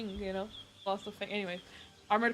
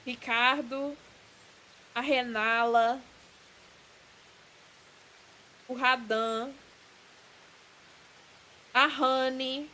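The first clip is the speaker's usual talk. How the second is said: game names said in Brazilian Portuguese; Brazilian accent; slower than usual. Shown as a demonstration. Streamer proving the characters all live in Brazil, Brazilian accent. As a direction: list the names as a Brazilian would, R said like H. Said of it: "say their name with a Brazilian accent".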